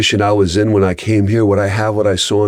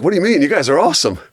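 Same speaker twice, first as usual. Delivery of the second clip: put-on higher, earnest little boy; a child who doesn't get it; puzzled kid; 9 semitones higher, much faster than usual. Son answering dad, higher puzzled child voice. Son defending the wharf rats.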